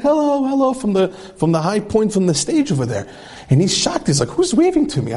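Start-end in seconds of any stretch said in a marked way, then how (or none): 0.0-0.7 high-pitched waving hello, called up from the bench; friendly hello. Retold or imitated. Judges waving from the stage. 4.3-5.1 sharply high, startled question; shocked. Retold or imitated. Man shocked at judges waving.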